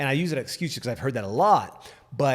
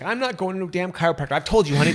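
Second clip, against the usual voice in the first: indignant refusal; 5 semitones higher, 7 dB louder than usual. Retold or imitated. Mom refusing in retold quarrel.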